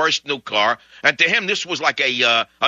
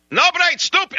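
put-on very high and louder Russian-accented yell; Russian screaming; 5 dB louder than usual. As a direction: shriek it like a furious Russian. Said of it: "he's screaming and yelling, the Russian".

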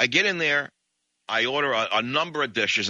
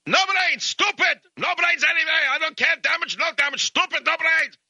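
put-on high-pitched yelling in a thick Russian accent; screaming Russian; 4 dB louder than usual. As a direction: rant like a crazed Russian whose bumper got hit. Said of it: "the Russian gets down on his knees".

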